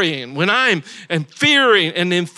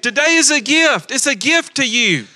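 emphatic repeat; 4 semitones higher than usual. Shown as a demonstration. Preacher repeating the line back to the congregation with fire. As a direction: lift it up and proclaim it. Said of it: "Everybody say".

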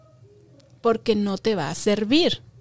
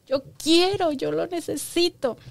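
higher whiny demand; begging child; 6 semitones higher than usual. Retold or imitated. Mother voices a child demanding from parents, higher whine. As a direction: insist like a needy kid.

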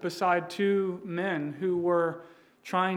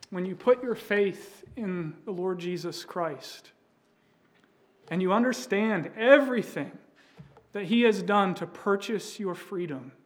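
preaching; 2 semitones higher, 4 dB louder than usual. Acted. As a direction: drive the point home to the congregation. Preaching climax to congregation.

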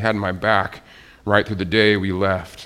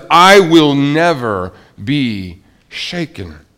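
confident declaration; 3 semitones higher, 7 dB louder, slower than usual. Shown as a demonstration. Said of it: "this confidence that says".

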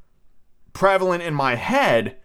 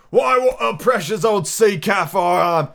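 higher, slow, rueful sailor voice; old sea dog; 6 semitones higher, 3 dB louder, slower than usual. Acted. Sea cook after a laughing fit, ruefully mocking himself. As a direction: chide yourself as a salty old seaman. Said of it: "he said at last, wiping his cheeks".

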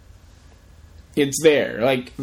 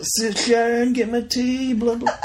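higher-pitched mumbling rock-star impression; 8 semitones higher, slower than usual. Mocking [name] from his reality show, high voice. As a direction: play the fading old rocker asking for tea. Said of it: "what's his, uh".

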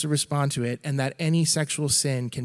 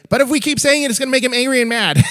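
put-on much higher and louder, quick child voice; little girl voice; 10 semitones higher, 8 dB louder, faster than usual. Dad imitating his daughter's earnest warning in a high voice.

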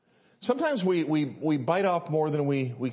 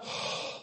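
acted heavy sigh of dismay; weary sigh; 9 dB quieter than usual. Climber hears how far remains, heavy acted sigh. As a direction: let out a voiceless, worn-out breath. Retelling an exhausting, frightening climb.

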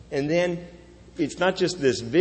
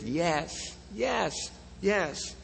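slow yes, fading softer with each repeat; emphatic yes; 6 semitones higher, 9 dB quieter, much slower than usual. Shown as a demonstration. Preacher giving God's emphatic answer, fading softly. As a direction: let each yes fall away quieter. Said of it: "is emphatic".

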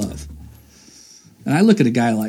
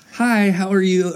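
feigned interest; 7 semitones higher than usual. Retold or imitated. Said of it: "trying to feign interest".

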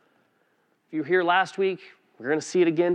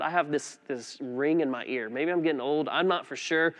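ordinary talk, nothing marked

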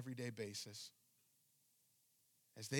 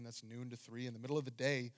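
normal talking voice

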